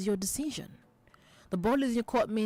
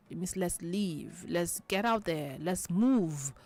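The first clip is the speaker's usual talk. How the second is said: usual speaking voice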